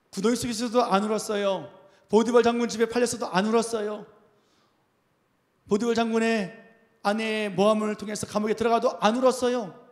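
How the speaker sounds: loud, emphatic pulpit build-up; forceful preaching. Real, not acted. Preaches Joseph's trials in rising series.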